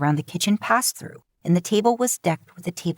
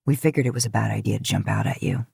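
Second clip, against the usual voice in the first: lower, quick, wry man's voice; Dean's voice; 7 semitones lower, faster than usual. Acted. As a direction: tease her lightly in a low voice. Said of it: "Dean said as he took his turn".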